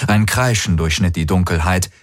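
ordinary delivery, nothing marked

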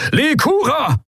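high, desperate roar of a name. A narrator's reading. The admiral roars to his friend to abandon ship. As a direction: bellow the name across the bridge. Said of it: "brüllte Telios".

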